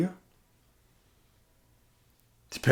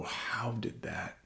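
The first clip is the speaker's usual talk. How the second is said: whisper; 17 dB quieter, much slower than usual. Retold or imitated. Stunned self-question.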